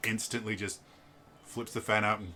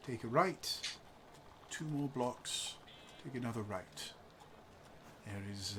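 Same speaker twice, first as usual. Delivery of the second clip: reserved husband; 10 dB quieter, slower than usual. Acted. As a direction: cut her off and steer the players away.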